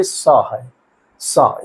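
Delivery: slow, careful सा, not खा; correct sa, not kha. Shown as a demonstration. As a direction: say सा with a hiss, never खा. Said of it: "कुछ लोग खा पढ़ते हैं".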